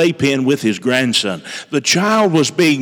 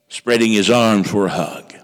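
ordinary delivery

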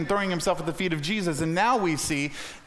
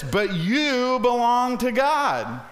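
slow, raised, ringing proclamation; emphatic declaration; 5 semitones higher, much slower than usual. Real, not acted. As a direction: draw out "you belong to God", pitched high. Preacher declaims a verse.

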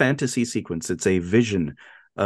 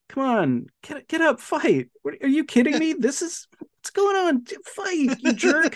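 much higher, hammed-up egging on; imitating a killer urging a fight, high; mock frustrated urging. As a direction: goad him up in a squeaky mock whine. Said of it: "He's like".

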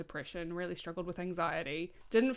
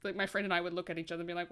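ordinary delivery

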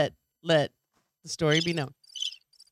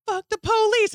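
mock shout; 5 dB louder, slower than usual. Rowdy women yelling, retold.

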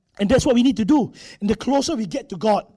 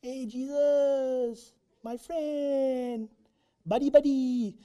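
mock buddy greeting; 4 semitones higher, much slower than usual. Overfamiliar greeting to Jesus.

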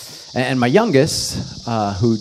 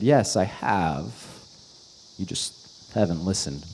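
quiet God reply; 3 semitones lower, 7 dB quieter than usual. Retold or imitated. Voicing God's reply.

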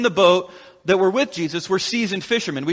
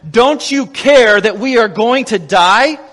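desperate plea; 4 semitones higher, 5 dB louder than usual. Retold or imitated. Desperate disciples rebuking sleeping Jesus.

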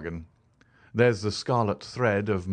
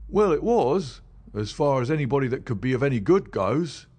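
higher, louder common London constable; a plain-spoken beat policeman telling his tale, pitched up; constable Rance; 6 semitones higher, 5 dB louder than usual. Acted. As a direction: policeman recounting what he found, higher and louder. Rance answers Holmes.

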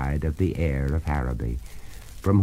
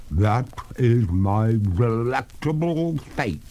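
slow, higher ghostly lady; water ghost; 5 semitones higher, 4 dB louder, slower than usual. Acted. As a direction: draw it out as a ghost.

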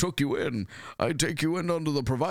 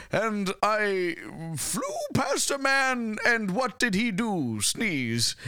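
slow, lilting recital of a poem; 9 semitones higher, slower than usual. Pretend. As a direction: recite it like a proud poet. Reciting his dandelion poem.

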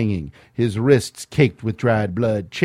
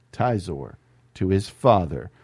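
usual speaking voice

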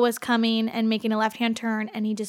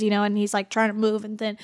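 ordinary delivery

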